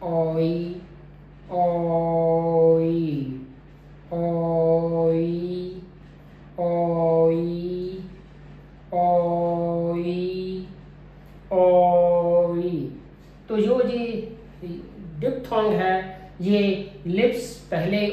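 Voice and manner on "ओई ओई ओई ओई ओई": ओई stretched very long, sliding from "o" into "ee"; smooth diphthong glide. Shown as a demonstration. Teacher modelling the phoneme for learners, long gliding ओई. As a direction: hold the first vowel of ओई, then glide without a gap. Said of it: "दो बाउल के बीच में जो smooth glide है".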